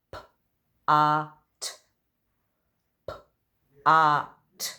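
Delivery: p-a-t, each sound stretched and separate, loud. Shown as a demonstration. Teacher sounding out a word for children to guess, loudly. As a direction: sound out every letter slowly. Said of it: "I'm going to sound out the words".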